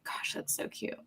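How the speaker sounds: soft unvoiced whisper. Real, not acted. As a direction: breathe it out in awe. Cooing at a cute mouse.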